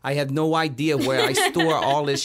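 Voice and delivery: broad, put-on Boston barfly; Boston accent. Shown as a demonstration. Bragging about all his voices on request, Boston accent.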